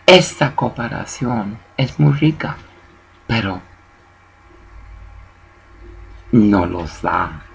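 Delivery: soft, low, gentle coaxing in Spanish; gentle polite Spanish. Retold or imitated. Telling workers politely in Spanish to mind the line. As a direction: ask sweetly and politely, mostly in Spanish. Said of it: "You say".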